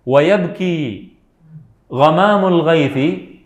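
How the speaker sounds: very slow, drawn-out chant; very slow recitation. Shown as a demonstration. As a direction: linger on every syllable. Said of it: "teacher recites verse very slowly".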